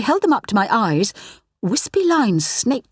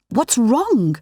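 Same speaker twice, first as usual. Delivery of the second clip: breathless worry; 4 semitones higher than usual. A narrator's reading. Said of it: "I repeated breathlessly".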